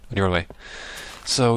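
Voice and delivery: ordinary talk, nothing marked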